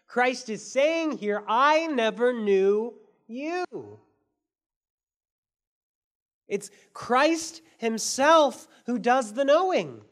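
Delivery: slow, loud and high, every word weighed; 1 slow emphatic preaching. Real, not acted. Preacher stressing Christ's words to the church, slow and loud. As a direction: lean hard on each word, slowly.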